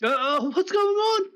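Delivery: put-on high, loud startled old man; jolted awake and confused; startled uncle. Uncle woken by urgent knock.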